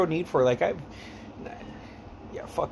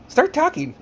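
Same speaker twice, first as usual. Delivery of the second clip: high, louder imitation of a friend; retold playful command; 14 semitones higher, 5 dB louder, slower than usual. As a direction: pitch way up, ordering playfully. Acts out friend's sudden demand.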